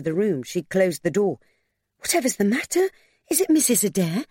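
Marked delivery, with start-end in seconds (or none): 2.0-4.2 high, anxious rising questions; alarmed question. A narrator's reading. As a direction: ask with mounting concern. Alarmed woman answers a distraught visitor.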